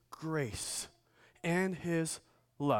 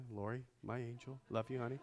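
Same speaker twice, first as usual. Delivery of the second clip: much softer, intimate and tender; tender aside to wife; 5 semitones lower, 12 dB quieter, faster than usual. Real, not acted. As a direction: speak softly to your wife. Compliment to his wife.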